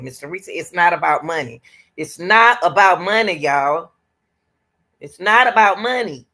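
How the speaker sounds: emphatic repetition, leaning on not; stress on not. Real, not acted. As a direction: insist it's NOT about money. Repeating it's not about money.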